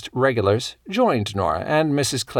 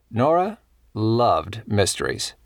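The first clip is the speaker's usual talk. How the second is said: usual speaking voice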